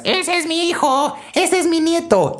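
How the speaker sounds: put-on very high-pitched proud old grandma shriek; grandma yelling from the stands. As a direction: screech it like a proud grandmother cheering from the bleachers. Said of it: "la abuelita que grita desde las trincheras". (grito agudo de abuelita orgullosa)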